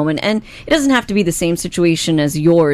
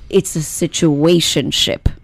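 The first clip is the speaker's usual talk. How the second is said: careful slow word; 2 semitones lower, slower than usual. Retold or imitated. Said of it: "Gotta say that very carefully".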